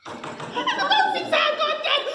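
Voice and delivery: high, slow, loud panicked cry; panicked shriek. Acted. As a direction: scream it in terror. Panicked repeat of announcement.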